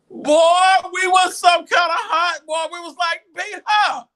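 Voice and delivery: high, loud indignant shout; yelling in disbelief. Retold or imitated. Recalling the unfair punishment, shouting loud.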